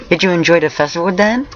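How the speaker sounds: high-pitched question rising at the end; high, curious-sounding character line. A narrator's reading. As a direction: lift it high, ask it lightly.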